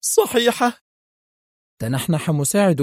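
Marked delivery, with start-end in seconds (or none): 0.0-0.8 a high, shocked cry of disbelief; woman screams at the news of a death; horrified scream. A narrator's reading. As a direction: shriek it in terror. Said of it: "وهي تصرخ".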